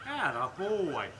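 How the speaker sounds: put-on slow, calm atta boy; calm praise. Shown as a demonstration. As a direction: praise him gently and slowly. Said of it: "Nice, calm praise".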